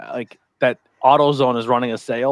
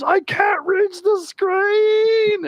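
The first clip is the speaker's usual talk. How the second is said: high, slow sobbing wail; mock crying wail; much slower than usual. Soaked coworker wails from the toilet, high sobbing cry. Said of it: "just screaming and crying".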